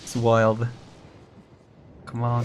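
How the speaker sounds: usual speaking voice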